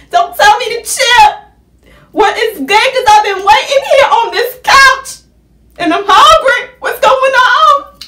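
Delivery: high, loud, heated yelling; angrily yelling at the boyfriend for making her wait hungry; furious rant. Retold or imitated. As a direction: go off on him.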